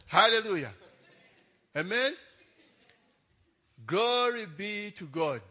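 loud, high preacher's call, slowing at the end; preaching shout. Real, not acted. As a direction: call it out like a pastor lifting the church. Preacher calls out to congregation.

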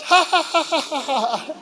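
high childish giggle, imitated; high, mock child laughter; imitating child's laugh. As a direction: mimic a little girl's giggle. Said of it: "Then she laughed".

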